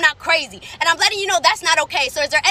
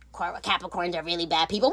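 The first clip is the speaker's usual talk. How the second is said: much lower, softer put-on voice; low, muted imitation of a hater; mocked critic; 9 semitones lower, 9 dB quieter than usual. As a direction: parrot the claim in a low mocking voice. Mimics claim before clapping back.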